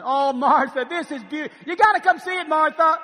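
high and loud, overjoyed; excited. Acted. Philemon calling Martha over to read the letter, delighted. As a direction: call her over, you can't wait. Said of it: "let's act this thing out".

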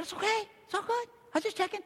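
high, soft and easygoing; soft, high-pitched no-worries tone; breezy reassurance. Retold or imitated. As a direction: wave it off in a light high voice. Reassuring the apologetic employee.

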